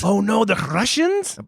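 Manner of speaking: drawn-out, high, hammed-up Russian accent; bad Russian accent. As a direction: attempt a terrible slow Russian accent with a rolled R. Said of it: "attempting to be a Russian accent".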